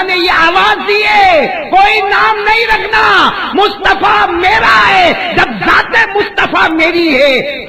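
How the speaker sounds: high, slow, booming call; proclaiming shout. Acted. Preacher voicing God's call from the sky, slow booming shout. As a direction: ring it out like a voice from heaven.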